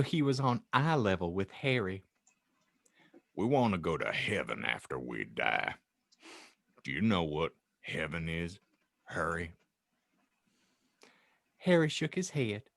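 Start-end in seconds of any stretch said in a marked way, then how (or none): 3.3-9.5 much lower, soft giant voice; gentle Hagrid. Acted. Hagrid telling Harry about heaven, low soft voice. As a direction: speak low and tender to a child.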